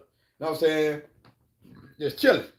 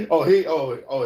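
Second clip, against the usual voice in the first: ordinary talk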